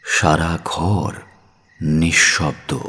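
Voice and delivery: ordinary delivery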